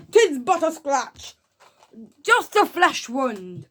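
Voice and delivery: slow, high, loud mock-heroic cry; wounded knight. Reviewer acting the knight's famous lines, slow mock-heroic shout. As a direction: declaim it as a hammed-up knight.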